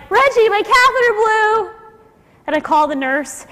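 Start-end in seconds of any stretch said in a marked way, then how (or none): 0.1-1.7 high, loud, slow alarmed call; mock cry out. Husband crying out to Reggie at night, alarmed shout. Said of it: "he cried out in the middle of the night".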